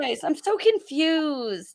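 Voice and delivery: high, slow bewildered voice; hammed-up lost tone; mock confusion. Joining the teasing with a dazed voice.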